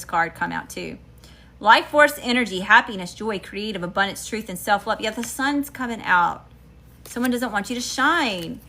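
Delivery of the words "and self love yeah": normal talking voice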